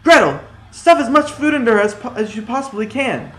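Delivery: loud high urgent shout; exclaiming. A narrator's reading. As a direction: cry out to his sister.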